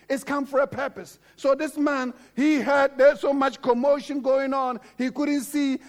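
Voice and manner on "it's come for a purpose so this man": normal talking voice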